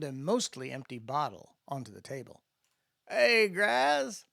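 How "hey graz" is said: lazy drawl, pitched higher; drawled greeting. Acted. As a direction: drawl his name, unbothered. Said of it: "Landon drawled".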